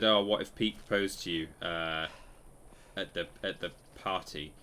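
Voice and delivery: usual speaking voice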